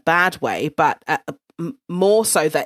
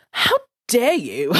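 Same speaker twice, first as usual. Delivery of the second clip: slow, higher-pitched retold outrage; affronted, with a laugh close behind; indignant; 5 semitones higher than usual. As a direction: draw it out in mock indignation. Retold indignation at her boss.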